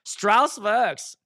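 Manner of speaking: hammed-up German accent, high and slow; exaggerated singsong German accent. Shown as a demonstration. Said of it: "in the German accent".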